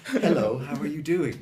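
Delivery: HELLO and HOW begun on a puff of air; dog-pant H. Shown as a demonstration. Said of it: "That's the sound".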